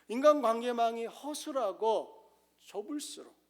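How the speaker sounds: usual speaking voice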